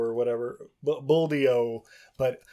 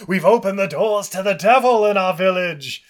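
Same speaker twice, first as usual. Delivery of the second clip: much louder, higher mock doom; hammed-up villager crying out; mock hunter warning of the devil; 6 semitones higher, 10 dB louder than usual. Hunter doom-laden outburst.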